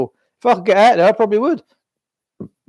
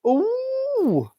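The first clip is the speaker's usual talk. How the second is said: sharp gasp, then long high-pitched "oh"; exaggerated pearl-clutching "ooh"; mock shocked gasp. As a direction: imitate scandalised onlookers with drawn-out, high oohs. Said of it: "the face of the ones when he got and walked out, they went".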